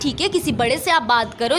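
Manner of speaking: ordinary talk, nothing marked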